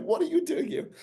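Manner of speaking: hammed-up disbelief in a high voice; mock incredulous squeak. Retold colleague, repeated in high voice.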